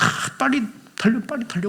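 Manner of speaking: high, eager hurry-up cry; eager urging. Pretend. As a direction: urge the cart on, impatient to see his son. Jacob eager to see Joseph. (요셉을 보고 싶어 재촉하는 높은 목소리)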